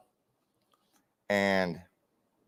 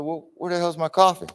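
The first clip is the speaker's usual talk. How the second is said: startled question; 9 semitones higher than usual. Retold or imitated. Retold surprise at missing coffee.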